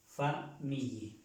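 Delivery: "Famille" split slowly, fa... MEE-ye; slow teaching "fa, MEE-ye"; syllable-by-syllable demo. Shown as a demonstration. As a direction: break "Famille" after fa, double L as y. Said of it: "sino famille".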